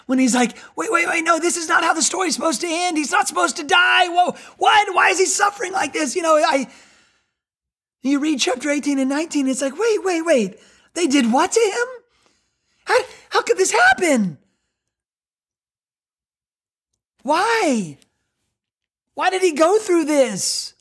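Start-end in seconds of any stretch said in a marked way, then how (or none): 0.8-6.2 put-on a child protesting the story's ending; upset kid from Princess Bride. The boy in Princess Bride objecting to the story, high and shouting. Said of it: "the kid in, um, Princess Bride". 9.8-20.7 high, pleading disbelief; stunned disbelief. Retold or imitated. Reader of John's Gospel begging to know why, high and stunned.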